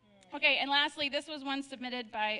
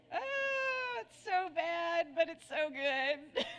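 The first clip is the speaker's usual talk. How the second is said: laughing; 7 semitones higher, much slower than usual. Real, not acted. Laughing at her own joke.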